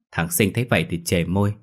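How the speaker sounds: plain reading voice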